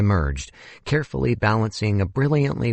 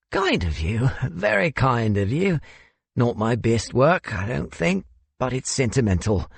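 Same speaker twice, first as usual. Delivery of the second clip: put-on higher-pitched, bashfully grateful older man; modest South African host; 5 semitones higher than usual. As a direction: accept the compliment modestly, in a higher voice.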